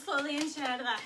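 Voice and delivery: normal talking voice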